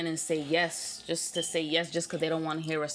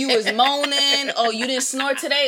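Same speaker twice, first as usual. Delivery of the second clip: sarcastic jealous partner, pitched up and loud; mock accusing girlfriend; 2 semitones higher, 7 dB louder than usual. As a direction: play the suspicious partner grilling him.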